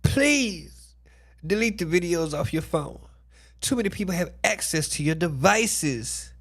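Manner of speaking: reading her own message. Retold or imitated. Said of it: "She said, I wrote".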